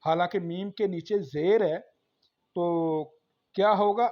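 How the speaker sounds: usual speaking voice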